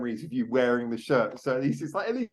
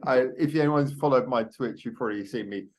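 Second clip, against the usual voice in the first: ordinary delivery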